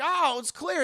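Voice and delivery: high, loud sigh of relief; relieved exclamation. Retold or imitated. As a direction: announce the danger is over, half mocking. Streamer acting out movie escape.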